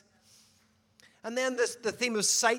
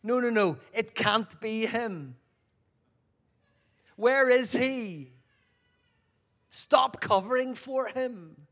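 louder, slow, impatient demand; insistent soldier; 3 semitones higher, 4 dB louder, slower than usual. Acted. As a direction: push hard, you won't be fooled.

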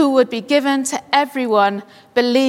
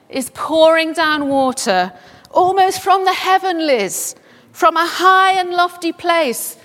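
rising preaching; 5 semitones higher than usual. Real, not acted. Preacher proclaiming the exalted Christ, building to hallelujah. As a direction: lift it up toward heaven in praise.

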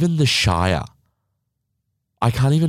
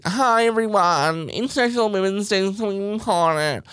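mock influencer; 12 semitones higher than usual. Vlogger mocks influencers posting for Women's Day, sugary high. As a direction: ham up a sugary Instagram post. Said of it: "being like".

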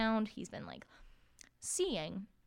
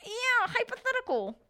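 high, put-on sneaky villain; mock Voldemort playing innocent; sly villain voice; 5 dB louder than usual. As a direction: wink through it like a schemer. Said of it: "Voldemort's like".

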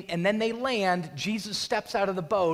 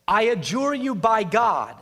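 loud, higher-pitched plea; pleading; 4 semitones higher, 7 dB louder than usual. Acted. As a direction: plead forcefully as the demons. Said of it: "and plead with him".

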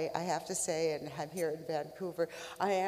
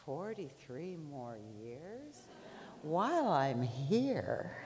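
drawn out, thinking it over; musing to herself, slowly; 4 semitones lower, slower than usual. Acted. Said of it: "she says".

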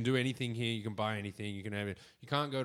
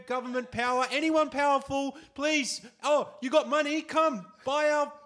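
high-pitched mock begging, building louder; desperate eager Australia; 9 semitones higher, 4 dB louder than usual. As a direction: plead like an over-eager suitor, ever more frantic. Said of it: "Australia be like".

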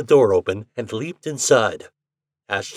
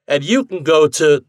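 loud, raised, cut-off retort; parting cry; 6 semitones higher, 9 dB louder than usual. A narrator's reading. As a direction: call it out until interrupted. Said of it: "he cried".

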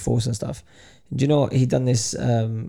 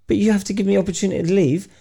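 higher, pleading argument; mock protest, pitched up; retold indignant protest; 7 semitones higher than usual. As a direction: imitate a man arguing his case.

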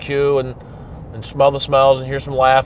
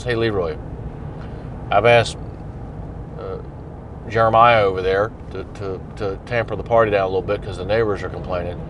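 nice and friendly; 6 semitones lower, 6 dB quieter than usual. Retold or imitated. Officer offering the drunk guest a ride home, gentle and low. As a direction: lower and softer, easygoing and kind. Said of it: "if you engage nicely".

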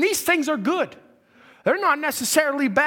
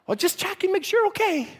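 high, sweet fussy mom; doting mother voice; 7 semitones higher than usual. Retold or imitated. Mother insisting.

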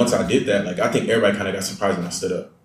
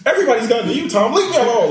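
high, raised-voice protest through laughter; hammed-up "leave me alone" rant; mock exasperated outburst; 14 semitones higher, 4 dB louder, faster than usual. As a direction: wail it at a friend, half laughing. Retold outburst at friend, laughing.

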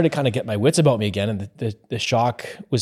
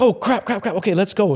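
high, hurried mock panic; flustered panic; 8 semitones higher than usual. Coworker retold rushing out to the truck, flustered panic. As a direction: imitate someone scrambling to leave.

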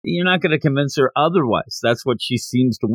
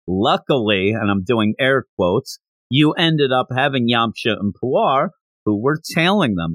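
ordinary talk, nothing marked

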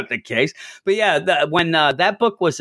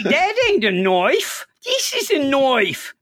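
slow, much higher mock Australian drawl; Crocodile Dundee quote; 12 semitones higher, slower than usual. Cohost gets the movie knife line in a slow mock Aussie accent. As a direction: play the famous knife line as a hammy Aussie.

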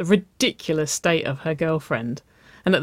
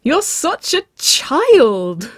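high, loud, drawn-out playful accusation; laughing squeal; 11 semitones higher, 8 dB louder, much slower than usual. A narrator's reading. Waverley smeared with pancake mix, squealing with laughter. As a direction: shriek it slowly in mock outrage. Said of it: "Waverley laughed".

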